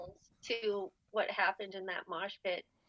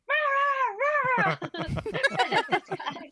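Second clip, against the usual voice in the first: mock rage noise; 11 semitones higher, 5 dB louder, much slower than usual. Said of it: "She was like".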